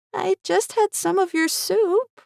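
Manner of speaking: put-on high, slow child's voice; an innocent small girl; little girl. A narrator's reading. Daughter telling father about the soup, high and slow.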